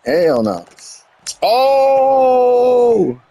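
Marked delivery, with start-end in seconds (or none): 1.4-3.2 long, held shout of oh, higher; loud drawn-out oh. Real, not acted. Reacting to a hit in the game, shocked long cry. Watching the wrestling match game.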